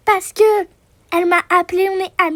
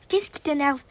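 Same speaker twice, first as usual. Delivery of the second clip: normal talking voice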